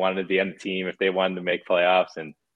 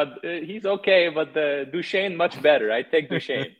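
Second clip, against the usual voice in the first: higher, slow mock Russian; a hint of the stubborn Russian player; Russian accent imitation; 5 semitones higher than usual. Panarin telling the coach who he wants, light mock Russian accent. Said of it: "That's what he said".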